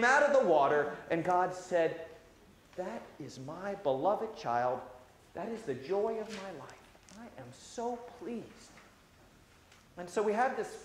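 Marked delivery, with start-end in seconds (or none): 2.7-8.7 tender divine voice. Acted. God speaking over us.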